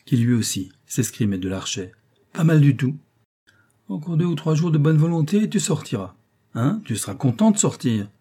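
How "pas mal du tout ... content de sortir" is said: higher-pitched encouragement; lifted, encouraging teacher's voice; approving. A narrator's reading. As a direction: coax the pupil with praise. Teacher praising and coaxing pupil.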